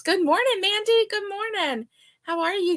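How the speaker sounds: high, bright sing-song hello; cheerful greeting. Real, not acted. Greeting viewer Mandy warmly.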